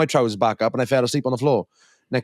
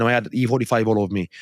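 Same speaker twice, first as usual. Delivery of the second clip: normal talking voice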